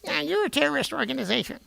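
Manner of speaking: high mocking taunt; a mocking echo of the enemy; mock reply. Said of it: "they come back with".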